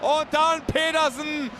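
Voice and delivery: fast, rising radio commentary; excited play-by-play. Acted. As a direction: call the chance as it happens.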